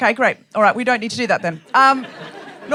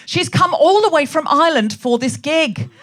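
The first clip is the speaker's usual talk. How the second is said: normal talking voice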